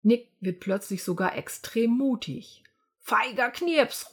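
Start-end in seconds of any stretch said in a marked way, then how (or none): 3.0-4.1 slow, drawn-out mock taunt; a little boy jeering at a mouse; taunting call. Nick jeers at Melanie the field mouse, taunting. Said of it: "ruft er Melanie".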